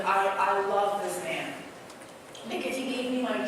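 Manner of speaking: ordinary talk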